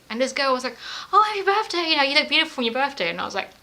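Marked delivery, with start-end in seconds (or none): 1.1-3.1 high, bubbly, quick well-wishing; gushing guest. Retold or imitated. Party guest wrongly wishes her happy birthday, high and gushing. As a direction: gush like a chirpy party guest.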